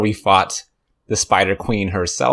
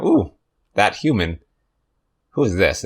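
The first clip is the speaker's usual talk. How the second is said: ordinary delivery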